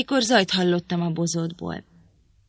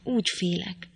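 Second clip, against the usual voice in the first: a softer, fearful echo trailing off; fading fear; 5 dB quieter than usual. A narrator's reading. Linton to Catherine, frightened of his father, fading whisper-soft. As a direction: let the repeated fear die away. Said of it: "lélegzet után kapkodva".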